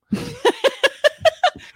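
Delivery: high, bright burst of laughter at her own question. Real, not acted. Laughing at her own question about weight.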